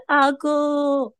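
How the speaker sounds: high wailing "阿姑" trailing into "阿祖"; mock mourning wail. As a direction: show the wail she was building up. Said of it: "我本来情绪是". (模仿哭丧的腔调)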